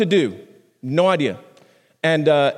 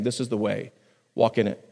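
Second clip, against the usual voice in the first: soft guiding voice; 6 semitones lower, 6 dB quieter than usual. Acted. Voice behind you quoted.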